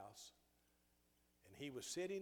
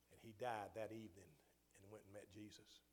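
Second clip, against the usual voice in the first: ordinary talk, nothing marked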